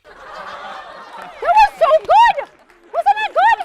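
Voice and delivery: high, loud, laughing delight; host thrilled the team guessed it; excited cheer. Real, not acted. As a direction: squeal with joy at the answer. Host cheers correct answer.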